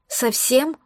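rising, small plaintive question; a hint of a whimper; plaintive, a touch. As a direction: ask it pitifully, softly. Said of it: "уточнила я жалобно".

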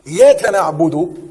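slow, drawn-out recitation of the verse; Quran recitation. Shown as a demonstration. Teacher reciting a verse for the class, slow and drawn out. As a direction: stretch each word like a reciter.

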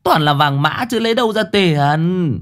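a mother crying out at ghost money; mother exclaims. A narrator's reading. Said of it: "Mụ kêu lên". (giọng bà mẹ kêu lên, cao hơn)

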